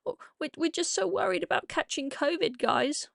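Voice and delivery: high, soft mocking whine of feigned worry; put-on high voice, sarcastically anxious; mock-worried protesters. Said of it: "It's not like they're all there going".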